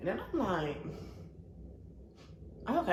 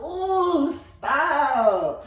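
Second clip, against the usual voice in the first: coy; 10 semitones higher, 7 dB louder than usual. Retold or imitated. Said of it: "I'm like".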